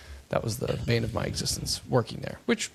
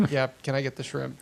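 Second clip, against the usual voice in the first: embarrassed laughing. Retold or imitated. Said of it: "And she's like".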